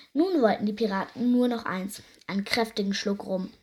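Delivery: ordinary delivery, nothing marked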